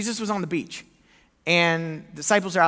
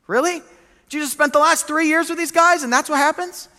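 much higher, louder disbelief; can you believe it; preacher astonished the disciples fled; incredulous; 10 semitones higher, 7 dB louder than usual. Real, not acted.

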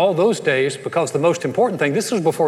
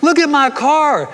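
high, loud, stretched-out boast; thrilled new car owner; excited showing off; 10 semitones higher, 5 dB louder, slower than usual. Acted. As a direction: show it off with big excitement. Acting a proud new car owner.

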